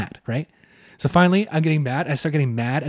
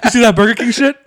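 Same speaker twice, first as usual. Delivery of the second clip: laughing mock brag; 6 semitones higher, 7 dB louder than usual. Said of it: "he's like".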